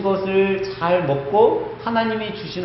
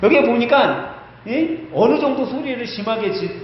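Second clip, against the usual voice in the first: usual speaking voice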